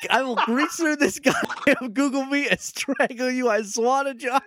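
high-pitched exasperated mock outrage; mock threat. Real, not acted. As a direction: threaten through rising near-laughter. Mock threat over co-host's whispering.

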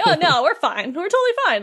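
high, over-insistent brush-off; mock reassurance. Sora reassuring kidnapped Belle, hammed-up insistence. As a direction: protest lightly that all is well, pitch leaping up.

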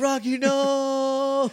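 high, slow drawn-out warning; mock alarmed plea. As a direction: stretch it out, too late to stop him. Friends scolding Rocky, retold.

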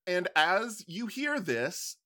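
ordinary talk, nothing marked

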